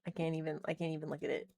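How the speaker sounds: ordinary delivery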